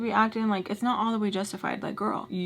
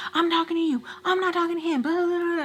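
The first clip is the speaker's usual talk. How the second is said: much higher-pitched mock screaming; retold psycho screaming; 9 semitones higher, faster than usual. Speaker screaming at her friend on the phone, retold psycho screaming. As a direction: imitate yourself losing it, without full volume. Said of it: "I start screaming, getting psycho".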